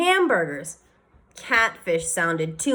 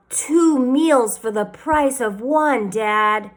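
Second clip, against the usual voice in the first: slow, eager pitch to dad; a boy selling his father on a deal; 4 semitones higher, 3 dB louder, much slower than usual. A narrator's reading.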